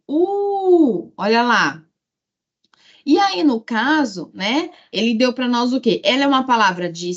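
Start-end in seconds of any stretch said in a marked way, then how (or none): 0.1-1.1 "U" longo e bem mais agudo; sílaba tônica isolada. Shown as a demonstration. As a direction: sustente "U" como a sílaba forte de baú. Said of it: "sílaba tônica tá onde?". ("U" esticado, em tom alto, para marcar a tônica)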